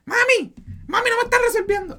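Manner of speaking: high, loud whining to her mom; high mock wail. Young woman calling her mother, high, loud mock wail. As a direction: wail it like a spoiled daughter.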